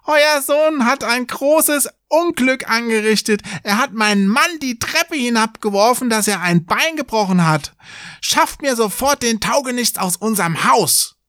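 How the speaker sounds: very high, agitated wife character; crying out. A narrator's reading. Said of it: "lief dann zu dem Vater des Jungen und rief". (aufgebrachtes Rufen in sehr hoher Stimme)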